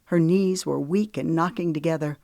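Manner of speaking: ordinary delivery, nothing marked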